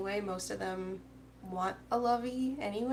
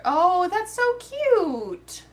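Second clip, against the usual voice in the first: high, loud, drawn-out squeal of delight; real gushing; 10 semitones higher, 11 dB louder, much slower than usual. Real, not acted. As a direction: coo over something adorable, stretching the words. Gushing over a cute crochet pattern.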